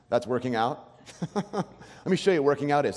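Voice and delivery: mocking scoff with a laugh; laughing gym-show-off scorn; scornful scoff. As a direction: sneer at the slow walker. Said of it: "you look at them like".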